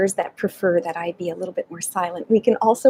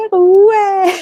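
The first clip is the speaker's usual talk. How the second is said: laughing cheer; 9 semitones higher, 6 dB louder than usual. Shown as a demonstration.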